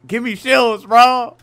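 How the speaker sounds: high, loud begging yell; desperate plea. Real, not acted. Gamer begging for shields mid-fight, loud high plea. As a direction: beg like you're about to die.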